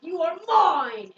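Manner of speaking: loud, drawn-out mock possessive cry; mock villain cry. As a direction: boom it slowly like a melodramatic villain. Villain's claim acted in retelling.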